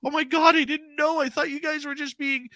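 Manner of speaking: high-pitched mock dismay; dismayed realization. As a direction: imitate mom's flustered, high-voiced realization. Mom realizing her mistake in story.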